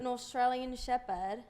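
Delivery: put-on slow, drawn-out Australian accent. Echoing a friend's dog breed, Australian accent. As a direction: put on a leisurely Australian lilt. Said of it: "Can you do an Australian accent".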